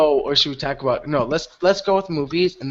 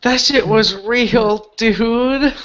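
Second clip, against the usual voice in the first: slow, higher, drawn-out dude voice; hammed-up drawl of amazement; mock dude voice; 6 semitones higher, much slower than usual. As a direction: stretch it out like an awestruck dude, mockingly. Mocks friend's real-life argument.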